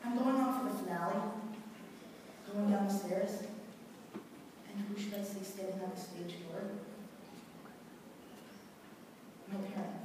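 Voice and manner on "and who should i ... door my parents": much softer, with a long pause; hushed painful confession. Acted. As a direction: let the reveal drop almost to nothing. Painful reveal of parents seeing him.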